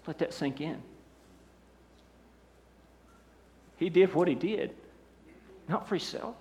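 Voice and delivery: low, soft, with long pauses; solemn, hushed pulpit delivery; hushed preaching. Real, not acted. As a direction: let each phrase sink in quietly.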